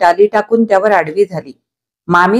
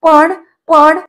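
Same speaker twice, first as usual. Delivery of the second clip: much higher, louder breaking words; choking up; 8 semitones higher, 4 dB louder, much slower than usual. A narrator's reading. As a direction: voice catching in the throat. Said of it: "त्या बालिकेचा गळा दाटला". (गळा दाटून आलेला आवाज)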